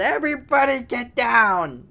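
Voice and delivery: high, loud robber's yell; yelled command. Acted. Robber ordering bank customers around, yelled. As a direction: shout the order out to the whole room. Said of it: "And they yelled".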